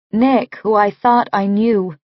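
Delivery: ordinary delivery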